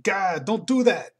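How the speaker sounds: high, loud startled shriek; freaking out. Acted. Comic guy freaking out at a sudden bam. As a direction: shriek it, jumping out of your skin. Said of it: "this guy's freaking out".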